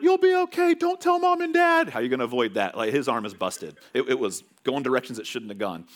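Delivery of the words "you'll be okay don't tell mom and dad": put-on high, loud kid voice; squeaky frantic boy begging secrecy; kids pleading. Kids to injured brother, high frantic plea. Boys reassuring brother, hiding it.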